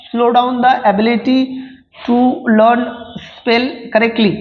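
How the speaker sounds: slow, deliberate dictation reading; reading exam options. Acted. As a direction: read each word out slowly for students to follow. Reads assertion aloud, slowly.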